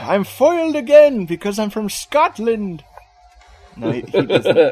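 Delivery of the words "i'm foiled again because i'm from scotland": put-on botched Scottish accent, high and loud. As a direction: wail it in a badly faked Scottish accent. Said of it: "I don't even know what that accent was".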